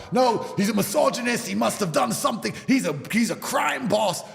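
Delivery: hammed-up scandalised accusing; mock accusation. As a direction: mimic indignant accusers piling on charges. Mimicking accusers' outrage.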